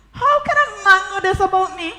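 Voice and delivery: high, incredulous questioning; astonished question. Acted. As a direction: wonder aloud with pitch lifted. Astonished that he knows her past.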